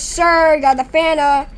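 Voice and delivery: ordinary delivery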